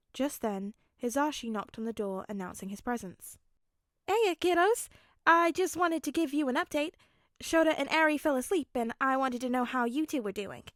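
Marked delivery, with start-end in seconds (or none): 4.1-10.6 bright, loud, higher friendly father; a loud, upbeat dad voice; cheerful host. Acted. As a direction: welcome them in with big cheer. Hizashi checks on the kids.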